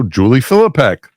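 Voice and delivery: usual speaking voice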